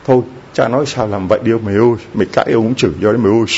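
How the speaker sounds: rattled off fast like a grumbling helper; grumbling mock quote. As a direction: hurry through it as a fed-up parishioner muttering. Parishioners grumbling about the priest. (nhại giọng càu nhàu, nói thật nhanh)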